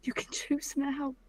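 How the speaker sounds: slow, barely voiced whisper. Daughter whispering encouragement to her father. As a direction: coax him gently in a whisper.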